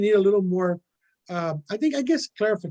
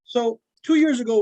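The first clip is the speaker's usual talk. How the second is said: a bit louder and higher; speaking up; 3 semitones higher, 4 dB louder than usual. Real, not acted. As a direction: project so the back row hears. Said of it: "It's just hard to hear".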